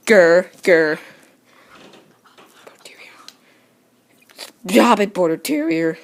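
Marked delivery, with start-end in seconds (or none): 2.7-3.1 breathy whispered name; hushed whisper. Real, not acted. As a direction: whisper it to the dog. Whispering the dog's breed.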